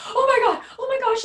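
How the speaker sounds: high, loud dismayed cry; stunned mother; shocked. Retold or imitated. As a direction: gasp it out in horror. Mom shocked by the news.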